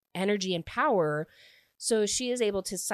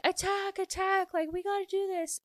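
mock yell; 12 semitones higher than usual. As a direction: mimic a commander yelling, high but held back. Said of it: "Cyrus is yelling at the army".

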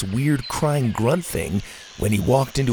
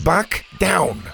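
sharp higher command to a dinosaur; firm order; 7 semitones higher than usual. Acted. Bo orders his T-Rex to back off, firm command. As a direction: snap it out like an order. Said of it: "Bo ordered".

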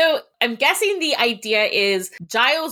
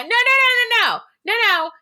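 squealing high insistent no; loud, high-pitched refusal; emphatic high no; 14 semitones higher, 3 dB louder than usual. Pretend. Mock protest at a withheld secret.

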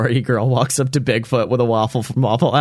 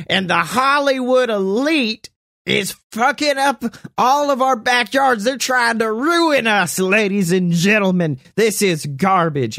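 ranting buster; 10 semitones higher than usual. Acted. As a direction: denounce him like a street preacher. Buster rants to crowd.

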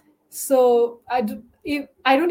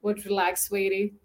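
low, soft Southern accent, imitating a gum-chewing waitress; lazy, relaxed attempt at a Southern drawl; Southern diner drawl; 4 semitones lower, 6 dB quieter, slower than usual. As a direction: try a laid-back Southern diner voice. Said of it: "they're chewing gum and like".

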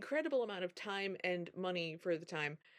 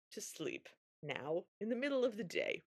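usual speaking voice

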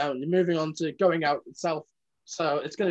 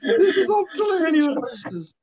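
laughing; 11 semitones higher, 5 dB louder than usual. Real, not acted. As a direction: wail it while laughing hard. Group cracks up at bad facts.

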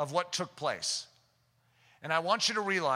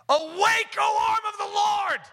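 much higher, loud shouted plea on Lord; fervent cry; 14 semitones higher, 6 dB louder, slower than usual. Real, not acted. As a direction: cry out to God with full force.

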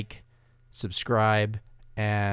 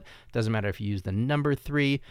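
usual speaking voice

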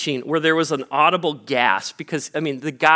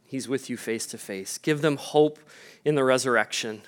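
earnest prayer; 4 dB quieter than usual. Real, not acted. Pastor praying for a grieving family in a soft, reverent voice. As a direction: pray quietly, with reverence and care.

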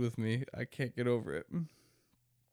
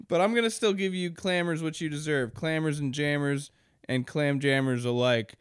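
usual speaking voice

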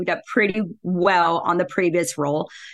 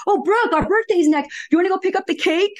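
higher, sing-song mock of a condescending colleague; lightly voiced coworker, faster, dripping condescension; mocking patronizing coworker; 10 semitones higher than usual. Male coworkers' patronizing requests.

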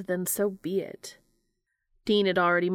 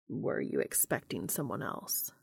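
lower, level and calm, a touch soft; Cass's low voice; 9 semitones lower, 7 dB quieter than usual. A narrator's reading. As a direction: ask evenly, low and unbothered.

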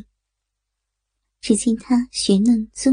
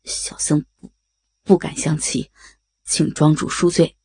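lower, stammering frightened monk; 8 semitones lower than usual. A narrator's reading. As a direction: stutter and beg for pardon. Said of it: "结巴地说".

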